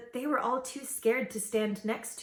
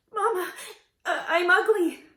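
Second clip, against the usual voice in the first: tearful; 8 semitones higher, 5 dB louder than usual. A narrator's reading. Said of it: "The princess sniffed".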